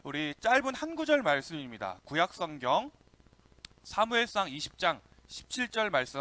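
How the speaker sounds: normal talking voice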